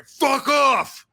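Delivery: loud, high-pitched angry yell; exaggerated full-force shout; mock furious shout. Shown as a demonstration. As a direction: blast it out as a hostile dismissal. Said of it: "You could read that and say".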